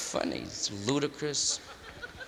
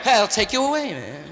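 normal talking voice

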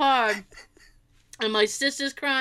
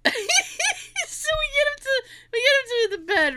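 laughing while talking; 13 semitones higher than usual. Real, not acted. Getting drunk dad to the bed, teller giggling helplessly. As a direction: struggle to speak through real laughter. Teller cracking up at own story.

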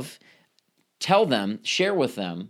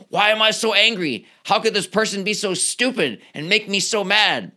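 mock angry question; 6 semitones higher than usual. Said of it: "instead of asking".

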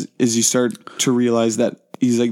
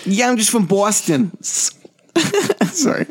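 put-on thick Boston accent, a bit higher; 10 semitones higher, much slower than usual. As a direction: play it up like a Boston local. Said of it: "doing this whole podcast in the Boston accent".

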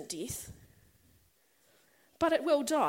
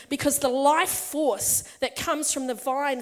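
ordinary talk, nothing marked

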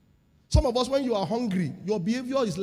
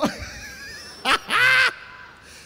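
big shouted laugh; loud laugh; 4 dB louder than usual. Pretend. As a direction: burst out laughing loudly. Acting out straining in restroom.

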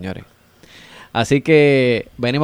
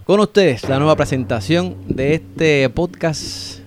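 grand entrance announcement; 4 dB quieter than usual. Acted. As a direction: announce it like a ringmaster. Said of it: "Una presentación como debe ser".

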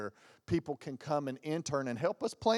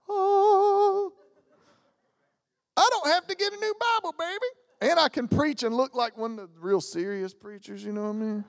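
high, loud, fast delight over reading glasses; thrilled squeal; 11 semitones higher, 6 dB louder than usual. Retold or imitated. Preacher gushing about his new reading glasses, squealing delight. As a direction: gush like a kid with a new toy.

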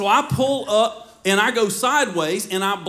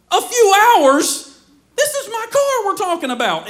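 outraged disbelief; 11 semitones higher, 6 dB louder than usual. Retold or imitated. To the 911 operator, high loud outraged disbelief. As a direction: stretch it out, stunned and indignant.

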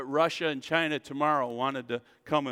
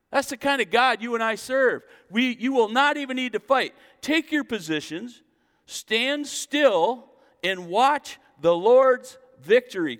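a preacher crying out the promise; preaching at full force; 9 semitones higher, 8 dB louder than usual. Acted. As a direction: proclaim it to the whole church.

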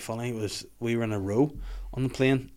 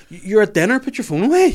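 much higher and louder telling-off; scolding shout; 12 semitones higher, 11 dB louder than usual. Pretend. Viewer shouting at the phone-addicted woman in the advert. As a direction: yell at her like a fed-up parent.

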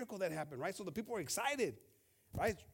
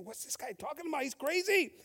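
offended followers; 10 semitones higher, 6 dB louder than usual. Retold or imitated. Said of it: "pero se estaban ofendiendo".

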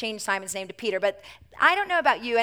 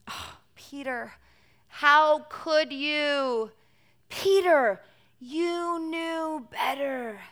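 disappointed scolding; 4 semitones higher, much slower than usual. Retold or imitated. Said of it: "Peter's probably thinking".

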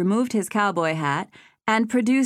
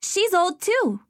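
put-on very high, blunt child; a small girl blurting it out; little girl voice; 12 semitones higher, slower than usual. A narrator's reading.

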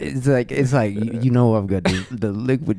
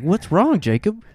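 concerned friend; 8 semitones higher than usual. Acted. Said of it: "he's like".

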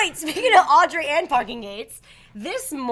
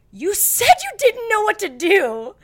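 exasperated; 5 semitones higher, 4 dB louder than usual. Retold or imitated. Exasperated at the attendant.

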